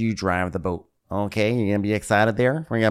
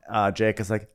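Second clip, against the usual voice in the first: ordinary talk, nothing marked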